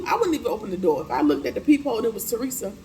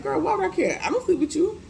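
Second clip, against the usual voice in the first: much higher, mocking voice brushing her off; mocking dismissal; 10 semitones higher than usual. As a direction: toss it off as a mocking high jab. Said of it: "the man was like".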